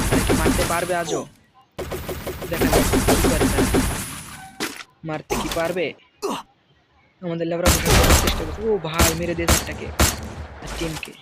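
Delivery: lively, rising play-by-play call; esports caster. Acted. Narrates the fight like a caster. (উত্তেজিত খেলার ধারাভাষ্য)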